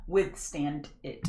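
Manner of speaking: WITHSTAND IT slowly, every consonant sounded; over-enunciate "Withstand it", drawn out; careful enunciation. Shown as a demonstration. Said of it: "I try to enunciate when I can".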